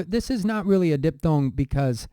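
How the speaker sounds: ordinary talk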